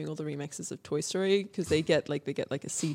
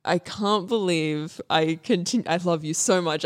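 usual speaking voice

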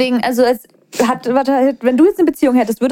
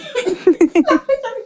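choking laugh; 10 semitones higher than usual. Real, not acted. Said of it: "Sie erstickt fast und sagt, sie lacht".

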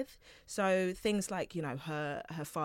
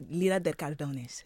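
Spanish accent; 4 dB louder than usual. Shown as a demonstration. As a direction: say the name with a light Spanish accent. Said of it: "if I put my little Spanish accent on there for ya".

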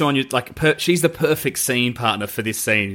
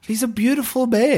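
high, far doting voice, much higher; doting gush; 10 semitones higher than usual. Retold or imitated. Podcaster gushing about Paddington at the film's start, doting high coo. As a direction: coo like you're melting over him. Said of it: "I'm like".

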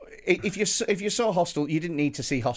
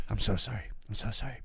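soft, lowered mock sorry; hushed mock apology; 4 semitones lower, 8 dB quieter, faster than usual. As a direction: mimic a presenter's hushed apology. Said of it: "he goes, he goes".

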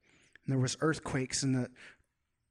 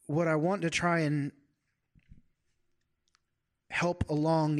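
usual speaking voice

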